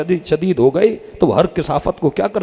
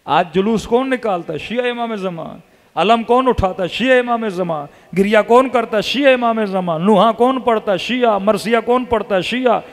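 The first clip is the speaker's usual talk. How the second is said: fervent preaching; 5 semitones higher than usual. Acted. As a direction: drive the questions home, each answer a hammer blow. Call and response rising preaching. (जोशीली तक़रीर, ऊँची और बुलंद आवाज़ में)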